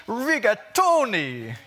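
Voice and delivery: hammed-up Italian accent, drawn out and loud; mock Italian accent, savoured slowly. Shown as a demonstration. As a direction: roll it out like an Italian chef, slow and loud. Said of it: "You've got to say it with an Italian accent".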